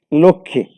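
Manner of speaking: লক্ষ্মী said as লোক্খি, m silent; লক্ষ্মী with doubled kh and no m sound; spoken pronunciation. Shown as a demonstration. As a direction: drop the m in লক্ষ্মী, say lokkhi. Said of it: "যুক্ত ব্যঞ্জনে মফলার উচ্চারণ হয় না".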